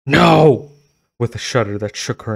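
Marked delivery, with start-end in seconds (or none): 0.1-0.7 loud, high cry of horror; horrified cry. Acted. Girl seeing her monster face in the glass, loud horrified cry. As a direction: scream it in disgust at yourself.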